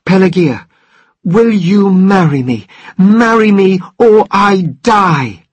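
loud, pleading, slowing to a drawn-out finish; dramatic drunken proposal. Acted. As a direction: beg her to marry you, all melodrama. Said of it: "declared dramatically".

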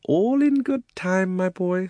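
high, slow patronizing drawl; condescending. Acted. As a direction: brush him off sweetly. Uncle condescending.

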